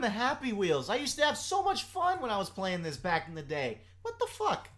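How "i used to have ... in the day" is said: ordinary talk, nothing marked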